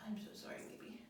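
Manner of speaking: hushed, breathy sorry to the puppet; fast whispered apology. Acted. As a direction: whisper it like you hurt his feelings.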